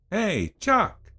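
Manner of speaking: high, loud shout across the bar; loud barfly call. Acted. Drunk Bonham calls loudly across the bar to Chuck. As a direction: holler a greeting too loudly for the distance. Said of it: "calling at me far too loudly".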